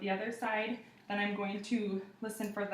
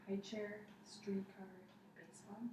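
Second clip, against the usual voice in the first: whispering; 12 dB quieter than usual. Shown as a demonstration. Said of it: "I'm gonna say three more two-syllable words".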